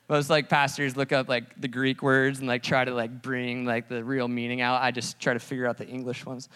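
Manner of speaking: normal talking voice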